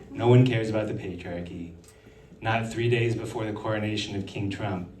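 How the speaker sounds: ordinary delivery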